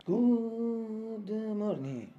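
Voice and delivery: high, very slow, sustained "good morning"; sing-out morning call, falling at the end; drawn-out greeting. Acted. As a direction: stretch the greeting out long on a held high note. Calls out greeting opening weather report.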